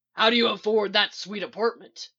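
loud, rough demand; gruff, loud interrogation. A narrator's reading. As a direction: demand an answer forcefully. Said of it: "Mick demands".

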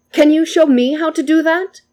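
put-on high, eager child voice asking to learn; a thrilled little boy begging to be shown; delighted young boy. A narrator's reading. Delighted boy asks the samurai to teach him, high eager child voice.

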